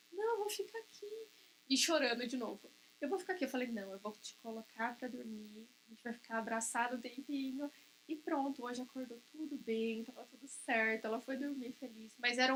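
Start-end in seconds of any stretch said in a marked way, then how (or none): 0.1-1.3 put-on higher, small child's voice, quiet and whiny; tearful child. Crying child refuses bed. 3.0-3.5 put-on whiny child repeating. Child repeats refusal crying. 3.7-7.7 soft reassurance. Retold or imitated. Mother tucks daughter in.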